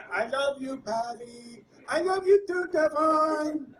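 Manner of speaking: loud, hammed-up mock impaired voice. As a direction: overplay a mocking impaired-speech impression.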